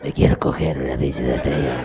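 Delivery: very low, loud mock growl; absurd crude request. Tourist leering at the taxi driver, deep booming mock voice. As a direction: drop the voice way down and boom it like a crude joke.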